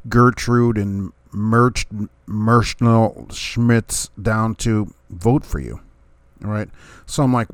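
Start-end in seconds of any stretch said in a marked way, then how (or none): 0.1-5.8 drawn-out names. Pretend. Mock-listing old volunteer ladies' names.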